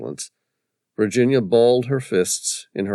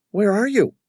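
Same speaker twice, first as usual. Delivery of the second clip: higher, concerned but not frantic; a hint of a worried woman on the phone; concerned caller; 14 semitones higher, 5 dB louder than usual. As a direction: ask like a worried wife. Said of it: "The woman's voice was concerned, but not frantic".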